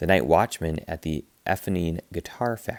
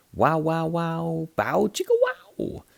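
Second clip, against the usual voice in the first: high loud wow-wow chicka twang; delighted high guitar-noise vocalizing; guitar sound imitation; 9 semitones higher, 3 dB louder than usual. A narrator's reading. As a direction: sing out the guitar's purr.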